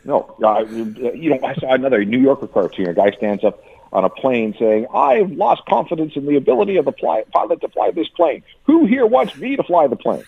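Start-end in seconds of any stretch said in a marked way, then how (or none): 4.9-10.2 high-pitched proclamation to a crowd; mock rabble-rousing passenger. Cartoon passenger standing up on a plane, mock rallying. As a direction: imitate a self-appointed leader making an announcement.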